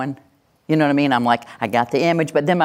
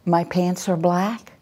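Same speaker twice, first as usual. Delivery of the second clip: higher, slow, questioning passive voice; 4 semitones higher, slower than usual. Shown as a demonstration. Trainer showing what a passive voice sounds like, unsure. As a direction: say it slowly as if asking permission. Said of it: "when you have a passive voice".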